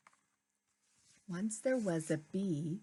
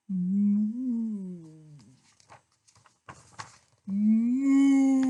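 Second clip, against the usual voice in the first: loud, drawn-out moo; cow mooing; 9 dB louder than usual. Acted. Cow speaks in story.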